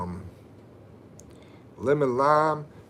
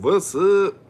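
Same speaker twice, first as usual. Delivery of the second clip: higher, louder mock shout; 10 semitones higher, 9 dB louder than usual. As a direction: yell the greeting in jest. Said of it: "Silly said".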